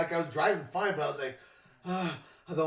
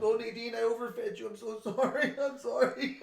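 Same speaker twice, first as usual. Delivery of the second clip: higher, apologetic, laughing through it; hammed-up guilty plea to the cat; mock guilty pet owner; 6 semitones higher than usual. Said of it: "I was like".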